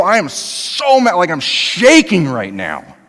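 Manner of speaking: high, slow, hammed-up rage; mock fury. Preacher voicing an angry person to the congregation, slow mock fury. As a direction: act out someone shaking with anger, overdone. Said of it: "But where you're like".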